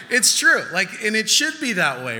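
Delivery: loud, high, through real laughter; laughing insistence. Real, not acted. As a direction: protest it while laughing.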